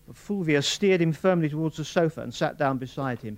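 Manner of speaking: plain reading voice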